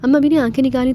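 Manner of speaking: ordinary delivery, nothing marked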